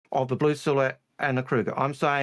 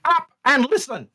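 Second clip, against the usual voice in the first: angry outburst; 10 semitones higher, 7 dB louder than usual. Real, not acted. Host cut off repeatedly by co-host, angry outburst. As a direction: lose your temper and demand silence. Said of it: "And yes, I'm annoyed".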